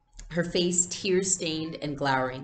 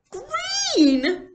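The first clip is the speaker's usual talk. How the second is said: much higher, louder long wail; 8 dB louder than usual. Acted. Little girl to her mother about green shoes, wailing. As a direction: wail it in despair. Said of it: "Kirsty wailed".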